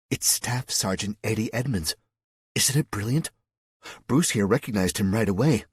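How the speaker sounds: ordinary delivery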